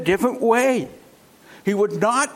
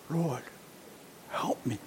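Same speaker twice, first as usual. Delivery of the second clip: much softer, lower broken plea; pained plea; 7 semitones lower, 15 dB quieter than usual. Acted. Kneeling woman begging Jesus, soft and pained. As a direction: barely get the words out through the pain. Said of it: "You can hear the pain in her voice".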